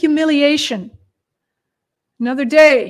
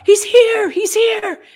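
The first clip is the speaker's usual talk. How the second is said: high, raised excited cry; crowd crying out; 8 semitones higher, 4 dB louder than usual. A narrator's reading. Crowd spreading word that he's here, crying out. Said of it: "he heard the crowd crying out".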